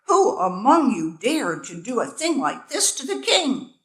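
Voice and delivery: loud, angry royal roar. A narrator's reading. King confronting the insects, angry roar. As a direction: roar the question at the swarm. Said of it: "the king roared".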